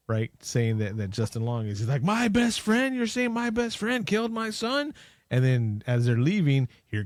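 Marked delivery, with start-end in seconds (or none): 2.0-4.9 high, incredulous mock outrage; a father who can't believe it; father told his best friend killed his son, voiced high in disbelief; shocked disbelief. Dad disbelieving the accusation.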